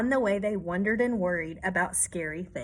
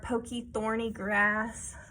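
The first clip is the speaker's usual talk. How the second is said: usual speaking voice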